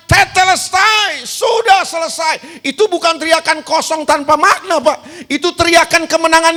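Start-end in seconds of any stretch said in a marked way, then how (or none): 0.1-2.6 slow, ringing shout of victory, pitched higher; triumphant cry. Retold or imitated. Jesus crying out on the cross: slow triumphant shout. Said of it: "Dia teriak dengan suara lantang".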